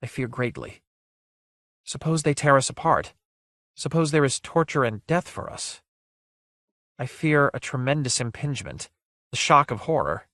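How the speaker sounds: dread, gently voiced; lightly voiced fearful maiden. Shirl tells Guile of her fears, lightly voiced dread.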